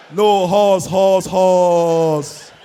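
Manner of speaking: "horse" for us, loud with a heavy H; Yoruba H factor. Acted. Yoruba lady correcting him, loud H-heavy "horse". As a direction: stretch the final "horse", H breathed in front. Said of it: "They're proud of their H factor".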